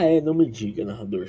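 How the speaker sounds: ordinary delivery, nothing marked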